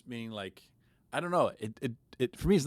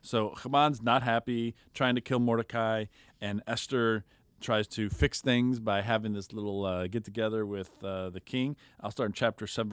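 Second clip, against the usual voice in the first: normal talking voice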